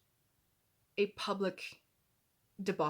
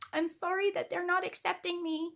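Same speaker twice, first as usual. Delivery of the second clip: much higher, whiny sarcastic "I'm sorry"; mock fake apology; 10 semitones higher than usual. Vlogger mocking the apology she expects, whiny and high. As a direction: mimic a self-pitying non-apology. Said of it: "don't apologize like".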